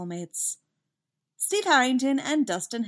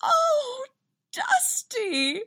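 very high, choked-up motherly coo; tearful joy; 3 dB louder, much slower than usual. A narrator's reading. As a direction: gush as a mom about to cry with joy. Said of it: "ready to cry with joy".

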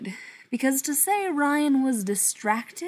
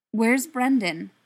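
nervous; 8 dB louder, slower than usual. A narrator's reading. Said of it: "looking nervously over his shoulder".

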